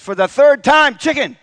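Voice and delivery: loud, high, fed-up snap at the husband; exasperated shout. Retold or imitated. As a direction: bark the punchline with irritation. Exasperated wife answers loudly.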